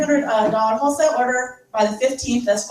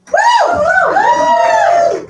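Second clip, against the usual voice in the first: high, loud whooping cheer; a big excited woo; 7 dB louder than usual. Real, not acted. Cheering a teammate's news.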